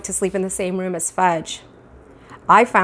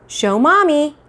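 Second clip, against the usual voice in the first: coaxing mother; 7 semitones higher, 3 dB louder, slower than usual. Acted.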